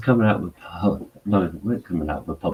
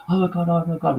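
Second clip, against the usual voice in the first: put-on high, gushing New York accent, oh my God; 13 semitones higher, 4 dB louder, faster than usual. Drunk New York woman gushing at a stranger, New York accent. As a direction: gush like a starstruck New Yorker, New York accent. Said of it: "in a New York accent".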